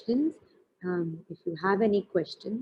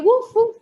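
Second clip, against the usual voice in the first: much higher and louder playful woof woof; dog barking; 13 semitones higher, 9 dB louder than usual. Shown as a demonstration. Teacher demoing the dog sound for children, playful bark. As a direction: bark like a puppy for the kids. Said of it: "Do the dog pose and say".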